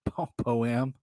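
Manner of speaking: poem restarted after a false start; tripping over poem. Real, not acted. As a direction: stumble on the first syllable of poem. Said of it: "I'm not even drunk".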